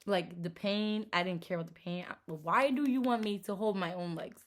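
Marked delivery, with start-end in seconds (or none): none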